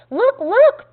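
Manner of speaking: high, loud excited call; excited cry. A narrator's reading. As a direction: cry out excitedly for everyone to look. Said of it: "I excitedly cried out".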